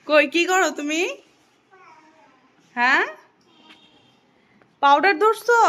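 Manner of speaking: called out loud and high across the room. Real, not acted. Mother catching her toddler at the powder, loud call.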